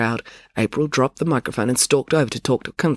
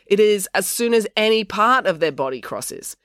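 higher, rule-book recital; pedantic quoting; 8 semitones higher than usual. A narrator's reading. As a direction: quote the rule to the crowd. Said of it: "he quoted from the book".

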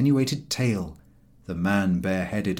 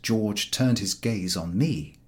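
usual speaking voice

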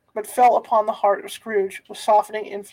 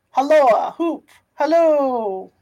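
long drawn-out hallooing call, louder; 2 semitones higher, 4 dB louder, much slower than usual. A narrator's reading. Scrooge calling after Friday, drawn-out halloo. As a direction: stretch the call out like shouting across a creek.

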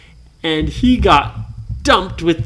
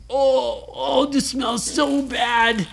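disgusted pirates; 8 semitones higher, slower than usual. Acted.

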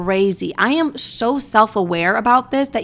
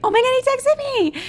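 very high, giddy re-enacted squeal; excited squeal. Telling viewers that texted-back feeling, squealing with excitement. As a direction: squeal it like a lovestruck fan. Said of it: "You know that feeling when they".

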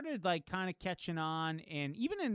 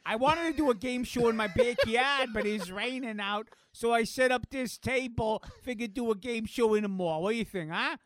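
louder, higher Italian-flavored game show host; Italian accent; 6 semitones higher, 7 dB louder than usual. Acted. Mall game-show host pitches his table in a loud put-on Italian accent. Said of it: "I don't know why I'm Italian now".

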